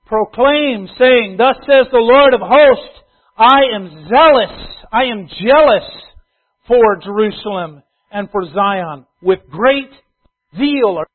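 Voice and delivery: loud, slow, booming scripture reading; forceful preaching. Acted. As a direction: proclaim it like a prophet thundering.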